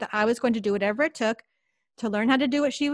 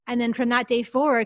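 normal talking voice

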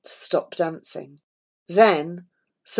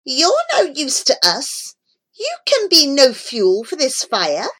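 high-pitched scornful flames; lightly voiced shrieking fire, rejecting him; pitched up, without full shouting; 6 semitones higher than usual. A narrator's reading. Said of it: "the flames shrieked".

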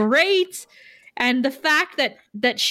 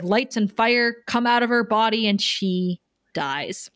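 normal talking voice

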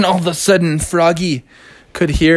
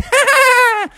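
very high rapid giggle; machine-gun cartoon chuckle up high; SpongeBob laugh; 3 dB louder than usual. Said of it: "and he said".

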